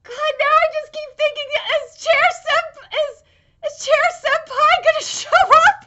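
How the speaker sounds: high, loud, worked-up fangirl voice; giddy excitement. Real, not acted. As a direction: squeal the question, getting carried away. Giddy speculation about Bleach reference.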